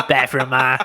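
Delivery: lightly voiced cartoon kid calling Ma; South Park impression. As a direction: imitate a cartoon kid through laughter. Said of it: "From South Park".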